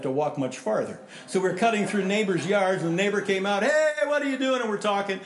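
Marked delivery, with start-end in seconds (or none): 3.6-4.6 mock neighbor's call. Neighbor to two men cutting through his yard, mock calling out. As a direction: imitate a neighbor hailing trespassers.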